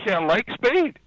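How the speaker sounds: high-pitched, emphatic correction; retold insistence. Crewman correcting the visitor on the name, insistent.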